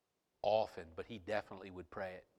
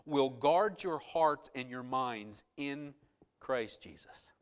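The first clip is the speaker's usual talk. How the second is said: loud, raised, emphatic sermon delivery; full-force preaching; 6 semitones higher, 11 dB louder than usual. Real, not acted. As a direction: drive each phrase home to the congregation.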